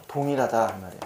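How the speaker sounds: usual speaking voice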